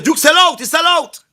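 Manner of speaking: mock accusing crowd. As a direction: mimic the crowd crying sell out.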